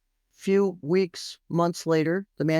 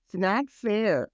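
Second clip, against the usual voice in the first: put-on drawn-out, higher childish whine; whiny kid; 5 semitones higher, much slower than usual. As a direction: stretch it out like a sulking kid. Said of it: "Just like a kid".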